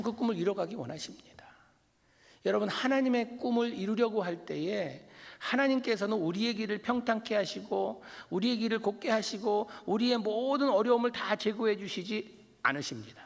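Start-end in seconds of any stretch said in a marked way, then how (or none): 2.4-13.2 rising, louder, building fervor; preaching. Acted. Pastor preaching to the congregation, climbing in force. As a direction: drive the point home to the pews.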